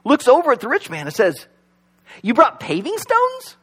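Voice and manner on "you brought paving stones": slow, high, puzzled question; confused Peter. Acted. Saint Peter baffled by the gold, slow and high.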